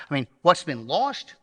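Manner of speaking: ordinary delivery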